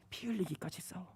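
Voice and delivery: breathy whisper of the command. Acted. God whispering to the believer, hushed. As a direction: hush it like a secret order. God's answer, whispered then repeated.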